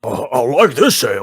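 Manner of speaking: loud, simple-minded character voice; hearty tavern oaf; Eglith. Acted. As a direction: blurt it out like a pleased dim drinker. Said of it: "Okay, Eglith, um".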